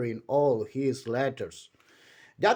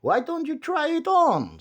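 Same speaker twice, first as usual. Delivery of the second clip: grinning shopkeeper; 13 semitones higher, 4 dB louder than usual. A narrator's reading. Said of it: "said Mister Bowler, grinning".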